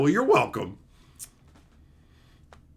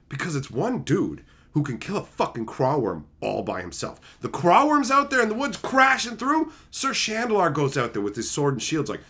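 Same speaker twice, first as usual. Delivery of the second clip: normal talking voice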